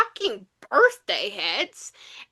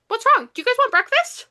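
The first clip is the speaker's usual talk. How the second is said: high, light questioning voice; innocently cheery; 9 semitones higher, 4 dB louder than usual. Retold or imitated. Retelling her breakfast offer to stunned guys, chirpy. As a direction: ask it airily, pitched way up.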